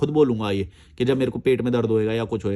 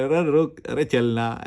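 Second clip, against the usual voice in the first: playful scolding call; a hint of a friend barking at you to wait; mock scolding; 2 semitones higher, slower than usual. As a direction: imitate him snapping at you to stop. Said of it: "अब वो डांट के बोले".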